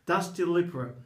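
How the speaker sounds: ordinary talk, nothing marked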